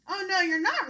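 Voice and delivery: loud, high roaring troll. Acted. Troll roars at the big goat, loud. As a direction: roar it out. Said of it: "roared the troll".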